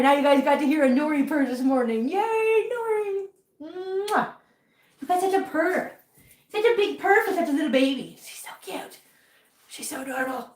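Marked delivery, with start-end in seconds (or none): 2.1-8.2 high, sing-song coo to a pet. Real, not acted. Praising cat's purr. 8.2-10.5 soft whisper not to disturb the cat. Real, not acted. Cat purring nearby.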